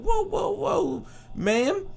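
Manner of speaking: high, slow placating whoa; mock startled. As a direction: back off from the angry woman. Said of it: "He said".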